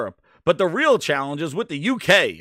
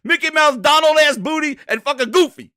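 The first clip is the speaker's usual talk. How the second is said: loud, high-pitched comic outrage; worked-up mock-furious shouting; exasperated rant; 8 semitones higher, 9 dB louder than usual. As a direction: rail at them as a bunch of clowns. Ranting at Microsoft after the bit.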